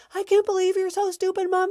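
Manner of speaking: hammed-up bratty kid; insulting kid. Kid calling mom stupid, high mock bratty jab. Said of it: "kid insulting mom".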